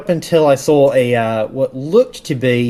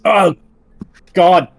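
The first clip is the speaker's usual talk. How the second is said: grunting; 3 semitones higher, 4 dB louder, much slower than usual. Acted. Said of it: "just grunts".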